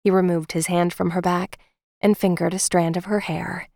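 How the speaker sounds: usual speaking voice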